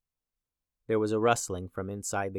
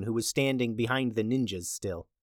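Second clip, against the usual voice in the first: plain reading voice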